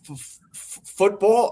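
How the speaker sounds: stammering F- f- then a high, rising football; nervous stammer. Retold or imitated. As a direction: kid too starstruck to get the word out. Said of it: "I couldn't even get it".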